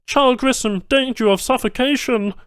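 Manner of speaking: urgent alien cry of danger; alien tech alarm. A narrator's reading. Banu tech warning of suffocation, urgent alien voice.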